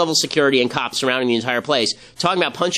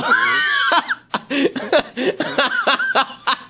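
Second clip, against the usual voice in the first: shrieking laugh. Real, not acted. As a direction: crack up helplessly at your own joke. Cracking up at his own joke.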